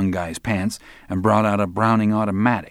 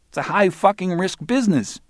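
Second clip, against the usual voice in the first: high-pitched cocky punk; cocky Barry; 11 semitones higher, 3 dB louder than usual. Acted. Barry talks down to Roland, high cocky voice. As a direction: lecture him like a know-it-all.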